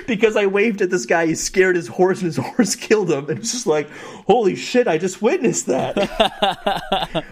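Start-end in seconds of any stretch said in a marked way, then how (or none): 4.3-7.2 laughing disbelief. Retold or imitated. Shocked reaction while laughing.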